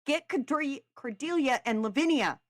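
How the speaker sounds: high, loud urgent call; shouted urgent command; yelled order. A narrator's reading. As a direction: yell for help from another room. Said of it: "Neve yelled".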